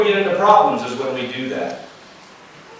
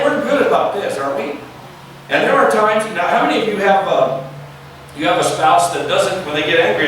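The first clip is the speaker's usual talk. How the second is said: normal talking voice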